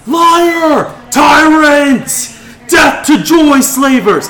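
high, full-force yells from an angry mob; crowd screaming. Acted. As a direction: scream accusations like a clamoring mob.

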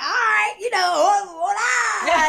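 high, loud, laughing greeting; bursting into the club in high spirits; excited hola. Retold or imitated. Greeting the club crowd, high and excited.

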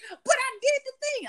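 high whine imitating a sulky gamer; mock whining gamer defending himself; whiny friend. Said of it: "He's like".

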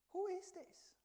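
high-pitched, astonished mock question; acted high-voiced bewilderment; awed question. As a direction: ask it in amazed disbelief.

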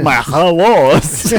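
slow, loud vampire greeting; drawn-out Dracula hello. Pretend. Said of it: "talk like a vampire".

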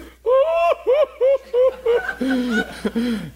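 loud, high wail imitating a sobbing man; long hammed-up crying howl; mock bawling. Preacher imitates the man crying on the floor, mock bawling. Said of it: "starts bawling uncontrollably".

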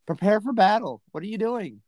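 high-pitched mock yell without much volume. Prophet rousing people to prepare for battle, mock yelling. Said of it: "almost like he's yelling".

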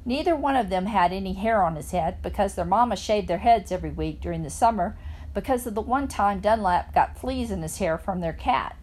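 ordinary delivery

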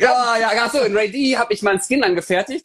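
hoch und laut lachend; laut begeistert. Real, not acted. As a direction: verbessert sich laut lachend. Corrects himself loudly. (laut und begeistert)

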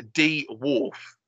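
"D wharf" split in two, slowly; "D wharf" with a separate dee before wharf; wife's mispronunciation. Retold or imitated. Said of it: "That's how she says it".

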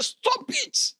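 shrill high cry of stop it; high retold shout. Priest snapping at the condemned man to stop. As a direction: cut him off sharply. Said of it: "He said".

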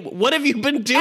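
high, exasperated demand; mock-outraged, voice shooting up; indignant outburst. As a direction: demand an explanation in disbelief. Acts out own indignation at the book.

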